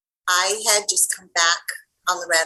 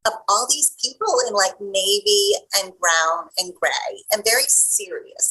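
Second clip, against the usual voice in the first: normal talking voice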